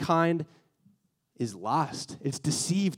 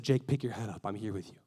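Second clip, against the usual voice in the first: gentle comfort; 7 semitones lower, 8 dB quieter than usual. Retold or imitated. God getting down to Jake's level, tender and hushed. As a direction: lower and much softer, tender reassurance.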